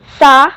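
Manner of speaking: सा with the tongue between the teeth; Urdu letter sound. Shown as a demonstration. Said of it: "सा जब हम बोलते हैं तो हम अपनी ज़बान को दांतों के बीच में रखते हैं". (सा ज़बान दांतों के बीच रखकर)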